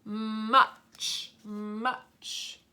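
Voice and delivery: "Much" stretched with a long m; sounding out. Shown as a demonstration. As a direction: draw out "Much" for spelling. Sounding out a word for spelling.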